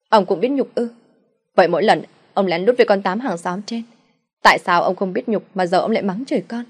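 wife confronting her husband about his affair, loud; accusing mother. Acted. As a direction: throw his shame back at him.

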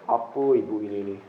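low, sneering voice of a mean person; lightly voiced sneering scold; mean-hearted scolder. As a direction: look down on the beggar. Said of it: "hatinya jelek".

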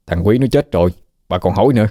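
ordinary delivery

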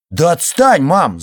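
loud, irritated snap at mom; annoyed shout; scolding. Acted. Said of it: "заругался тот".

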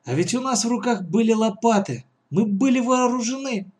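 high-pitched regretful protest; frustrated boyish complaint; vexed. Acted. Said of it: "с досадой воскликнул Лёшка".

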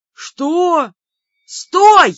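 high, loud outraged exclamation; indignant yell; loud indignation. A narrator's reading. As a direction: shout to stop someone, outraged. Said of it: "громко возмутился Бер".

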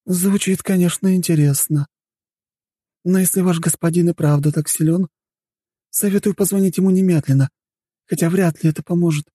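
high-pitched female character, coolly doubtful; skeptical woman guest. Acted. Woman advising the staff to phone their master, skeptical.

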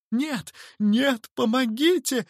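high-pitched cry for help; panicked wail. A narrator's reading. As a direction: wail like a man under attack. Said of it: "вопил мистер Хорспот". (испуганный вопль)

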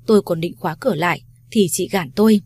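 usual speaking voice

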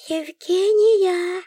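high, drawn-out outraged hiss; a creature hissing her name; indignant hiss. A narrator's reading. Creature indignant at the heroine, high slow hiss. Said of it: "возмущённое шипение".

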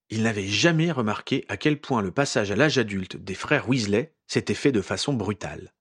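ordinary delivery, nothing marked

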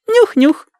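very high chirpy sniffing; chirping bird person. Acted. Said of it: "чирикнула Адати".

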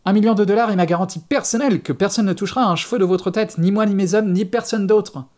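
high, loud, pressing plea; urgent. A narrator's reading. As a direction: push the offer with urgency in the voice. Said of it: "se mit à parler plus vite, une note d'urgence dans la voix".

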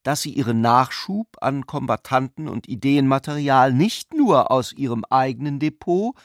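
normal talking voice

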